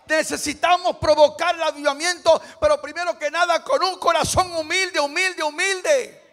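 very loud, high preacher's cry; full-force preaching. Real, not acted. Preacher urging the congregation to humble hearts, full-force preaching. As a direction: thunder it out to the congregation, high-pitched.

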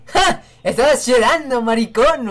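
loud, high mocking laugh-yell; mocking shout. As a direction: jeer at him like the punchline. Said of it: "Le dicen".

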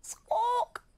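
very high squawking bird call; flamingo squawk. Acted. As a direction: squawk like a flamingo instead of a roar. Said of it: "said Arnold".

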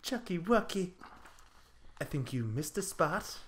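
soft, teasing coo; cooing softly. Acted. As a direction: coax him in, sweet and low. Plant coos at her lover.

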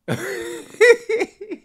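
high, loud cackle; high laugh burst. Real, not acted. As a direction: cackle at the tweet. Laughing at tweet.

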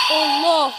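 a loud frightened cry of Allah, then a shaken question; jump-scare shriek, then what was that; startled scream. Real, not acted. Jump scare in horror game.

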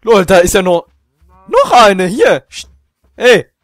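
loud excited calling out. Real, not acted. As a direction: shout with delight. Excited at finding cows.

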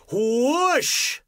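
high blowing whoosh sound. Acted. Narrator making the whoosh of the house falling. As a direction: blow the house away.

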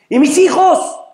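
high-pitched panicked question; crying out. Acted. Man about to be taken asks after his children, crying out. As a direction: throw it out in alarm. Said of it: "él empieza a gritar".

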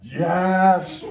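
loud, high, drawn-out "Yes"; hammed-up emphatic agreement; imitated local women. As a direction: belt it back as the second gossip. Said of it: "Then the other one would say".

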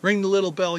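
ordinary talk, nothing marked

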